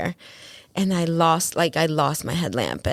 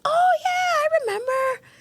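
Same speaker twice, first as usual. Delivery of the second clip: very high-pitched dawning recall; squeaky-high mock realization; sudden recognition. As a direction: imitate someone suddenly remembering, voice shooting up. Acting out his sudden recognition.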